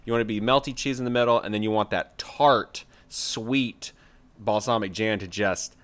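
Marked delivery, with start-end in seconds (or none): none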